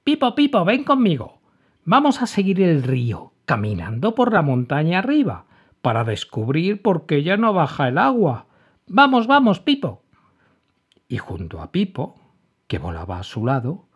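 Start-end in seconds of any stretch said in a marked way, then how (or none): 0.0-10.0 high, loud eager calling; rally your friend to come along; calling eagerly. A narrator's reading. Emma calls her bird friend.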